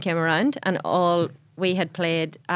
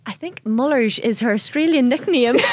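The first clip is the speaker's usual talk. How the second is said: put-on Irish accent; 5 semitones higher, 4 dB louder than usual. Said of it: "the Irish behind are like".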